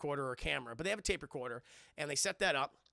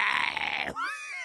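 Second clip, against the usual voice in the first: loud high laugh; 6 dB louder than usual. Real, not acted.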